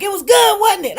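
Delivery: imitating the diners shouting with joy; excited shout. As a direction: yell it out in glee. Others exclaim about the meal.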